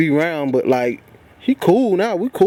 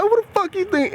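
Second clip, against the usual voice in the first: high, raised voice at the boss; angry retold yell; 13 semitones higher than usual. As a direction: yell it back at him, offended. Walking up to him angrily.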